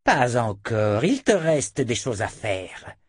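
slow, deliberate taunt; drawn-out menace. Acted. Dena to her failing captive, slow menacing insistence. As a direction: stretch the words out slowly.

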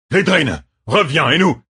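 high, urgent battlefield shout; calling him back. A narrator's reading. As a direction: yell at your officer to retreat. General calls officer back in battle. (rappel crié, urgent et aigu)

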